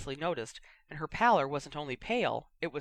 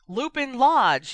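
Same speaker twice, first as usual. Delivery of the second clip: roaring; 8 semitones higher, 10 dB louder, slower than usual. A narrator's reading. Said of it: "he roared at the flames".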